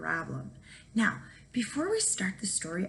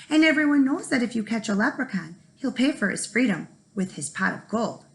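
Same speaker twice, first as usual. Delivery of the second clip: ordinary delivery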